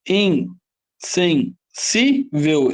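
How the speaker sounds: "Insensível" slowly split as In - sen - SÍ - vel; slow, separated syllables in "Insensível". Shown as a demonstration. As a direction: sound out "Insensível", weight on SÍ. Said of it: "é a penúltima?".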